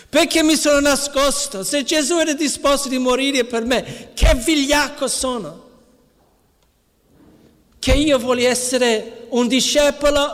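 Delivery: Nicodemo che si pente. Acted. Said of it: "Sicuramente pensava". (voce più alta, rimprovero a se stesso)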